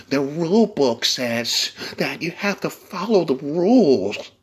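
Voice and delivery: mocking prissy voice. Mocking rule-followers.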